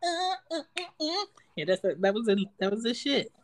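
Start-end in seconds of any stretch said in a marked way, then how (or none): 0.0-1.2 high stammering voice; high mock stutter. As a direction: sputter in a high voice.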